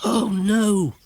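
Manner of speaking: high, dismayed oh no; a little broom realising trouble; broomstick dismay. Acted.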